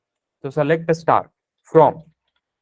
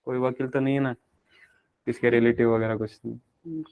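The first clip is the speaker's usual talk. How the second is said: lower, softer voice; joking aside; 5 semitones lower, 6 dB quieter than usual. Real, not acted. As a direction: drop the voice for a discreet check. Joking check after lawyer remark. (दबी आवाज़ में मज़ाकिया सवाल)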